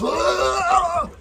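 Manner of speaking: sudden high shriek like someone overcome at a revival; imitating a worshipper shrieking at a charismatic meeting; mock ecstatic shriek. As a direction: let out a wild, high cry. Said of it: "people are going like this".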